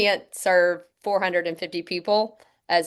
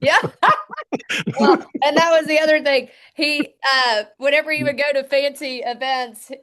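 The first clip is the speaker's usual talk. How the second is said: laughing; 8 semitones higher, 5 dB louder than usual. Real, not acted. Laughing about dad's fancy introductions.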